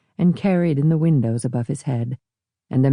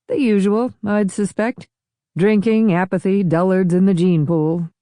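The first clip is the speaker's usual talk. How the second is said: raised, breezy and dismissive; smug host; 6 semitones higher than usual. Acted. Rich host to his guests, smugly dismissive. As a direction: brush it off with a knowing air. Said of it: "The host smiled".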